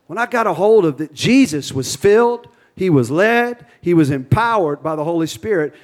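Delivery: loud, high fervent preaching. Real, not acted. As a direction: preach with rising fire. Preaching at full force.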